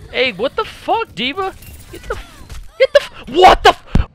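high, cut-off indignant cries; kill-steal outrage; outraged yelps. Real, not acted. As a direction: squeal in disbelief at the teammate.

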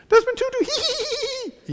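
extremely high helium voice into squealed giggle; Desmond Tutu. Retold or imitated. Comic imitating Tutu's laugh, helium-high squeal. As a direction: impersonate a tiny, piercing, high-pitched laugh. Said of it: "he has the highest pitched laugh".

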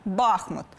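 Бахмут with the first syllable stressed. Shown as a demonstration. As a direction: punch БАх- at the start of Бахмут. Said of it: "наголосом на першому складі". (наголос на першому складі: Бахмут)

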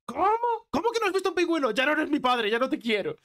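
very high, indignant mock outburst; incredulous, high acted rejection; outraged son. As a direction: disown your father in high-pitched disbelief. Son angrily disowns father in joke.

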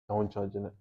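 ordinary talk, nothing marked

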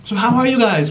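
normal talking voice

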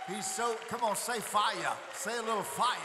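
loud, high call to the crowd; rousing preacher shout; shouting hype. Real, not acted. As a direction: fire the crowd up for him. Hyping the crowd about Mike.

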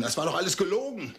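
betrayed husband screaming at his cheating wife; furious shout. Acted. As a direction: let the jealousy explode. Jealous husband erupts in rage.

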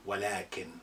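ولكن said softly, wa-laa-kin with the long a. Shown as a demonstration. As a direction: model ولكن gently for the learner. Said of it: "you have to say it like this".

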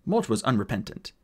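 usual speaking voice

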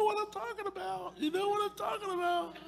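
falsetto. Acted. Falsetto to the men.

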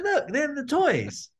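put-on very high, slow and pleading child voice; excited little kid. Boy showing mum the Star Wars toys, high pleading voice. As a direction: draw out the toys like a begging kid. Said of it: "I was like".

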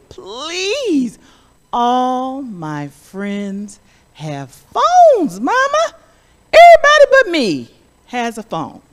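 put-on pleading child voice, high and loud; begging child. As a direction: beg Mama like a whiny kid. Child begging mother for phone.

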